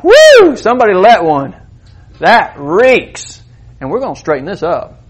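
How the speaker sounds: loud high mock outrage; hammed-up mom sniffing out trouble; mom catching a smell. Mom calls out the kids who are misbehaving, with a loud, mock-outraged whoop. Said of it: "she's like".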